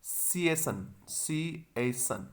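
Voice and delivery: teacher breaking the word into parts; syllable drill. Shown as a demonstration. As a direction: sound out सीएसन slowly. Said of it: "उसके बाद में".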